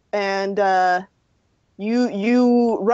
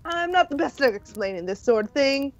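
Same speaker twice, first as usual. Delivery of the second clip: lightly played evasive rescuer, often higher; Alex; 6 semitones higher than usual. Rescuer fielding a newcomer's cult question, lightly played and evasive. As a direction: dodge awkwardly, then brightly change the subject.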